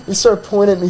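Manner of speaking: normal talking voice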